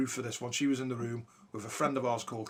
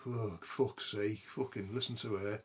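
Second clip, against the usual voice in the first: low, slow exasperated aside; retold private thought; 4 semitones lower, 7 dB quieter, slower than usual. Listener silently fed up with friend's story, low exasperated thought. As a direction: grumble it softly to yourself. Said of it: "in my head, I'm going".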